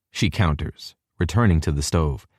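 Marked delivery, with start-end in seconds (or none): none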